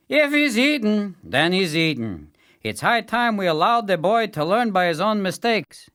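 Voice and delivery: high, loud, firm fatherly voice. A narrator's reading. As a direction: take a stand for the boy. Father voiced taking a stand.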